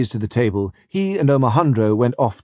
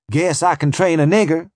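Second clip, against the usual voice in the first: boastful Southern trader; 6 semitones higher than usual. Acted. Bradley voiced, bragging trader.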